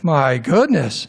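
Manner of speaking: slow, drawn-out "My goodness"; overwhelmed dismay. Acted. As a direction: stretch it out as if stunned by the whole mess. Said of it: "you'd pass out".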